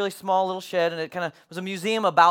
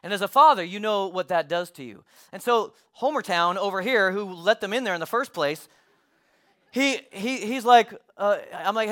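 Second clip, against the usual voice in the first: normal talking voice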